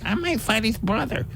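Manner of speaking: high, soft imitation of a fighter; mock boxer voice. Host mimics boxer after the fight, high mock voice. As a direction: put on a high little voice for the boxer.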